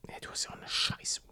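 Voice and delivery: whispered teasing jab; whispering. Real, not acted. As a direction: whisper the insult fast. Teasing insult about friend's watch.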